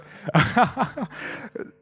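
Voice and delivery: second burst of high, loud laughter; real laugh bubbling back up. Real, not acted. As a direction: crack up mid-sentence in ha-ha-ha. Still laughing at his joke.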